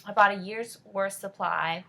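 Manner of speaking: normal talking voice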